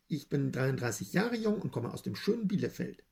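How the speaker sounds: usual speaking voice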